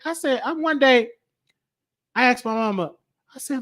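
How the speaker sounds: usual speaking voice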